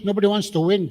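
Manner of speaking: ordinary talk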